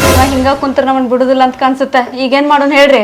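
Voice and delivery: soft, low conspiring murmur; hushed aside. Acted. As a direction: confer quietly with your husband. Wife confers aside with husband.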